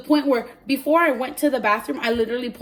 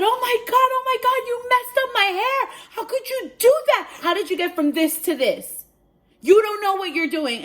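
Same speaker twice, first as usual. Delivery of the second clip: high, loud, outraged squeal; freaked-out screaming; 13 semitones higher, 5 dB louder than usual. Retold or imitated. Client blames hairdresser for ruined hair, freaked-out screaming. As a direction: hurl accusations in a retold shriek. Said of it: "So I was freaking out. I was screaming".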